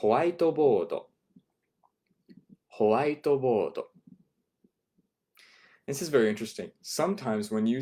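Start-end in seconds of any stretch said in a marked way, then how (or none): 0.0-1.1 Whiteboard said as howaitoboodo, slowly; Japanese loanword. Shown as a demonstration. Teacher modelling the Japanese whiteboard slowly. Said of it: "The word for a whiteboard". 2.8-3.8 Whiteboard said as howaitoboodo, slowly; Japanese loanword. Shown as a demonstration. Teacher modelling the Japanese whiteboard slowly. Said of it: "The word for a whiteboard".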